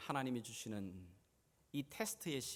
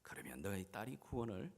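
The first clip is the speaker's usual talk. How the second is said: low, soft closing assurance; quiet promise; 4 semitones lower, 6 dB quieter than usual. Acted. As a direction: drop from the shout to a gentle promise. 외침 뒤 조용한 약속. (낮고 부드럽게 약속하듯)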